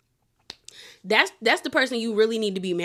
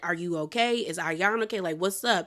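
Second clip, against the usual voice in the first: ordinary delivery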